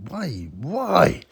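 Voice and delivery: slow drawn-out despairing why; exasperated. Retold or imitated. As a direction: plead to the heavens in mock despair. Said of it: "I'm like".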